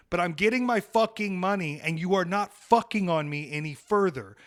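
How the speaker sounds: ordinary delivery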